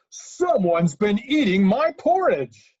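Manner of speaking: loud, slow, drawn-out bear roar; huge, high-pitched bellow, word by word; great huge Papa Bear. Acted. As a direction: belt it out as an outraged giant bear. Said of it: "in his great, huge voice".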